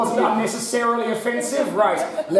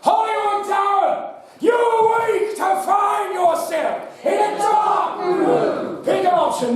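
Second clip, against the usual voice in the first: dramatic announcer; 12 semitones higher, slower than usual. Acted. Host sets the scene for the player in a grand announcer voice. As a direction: boom the scene out slowly. Host's dramatic game narration.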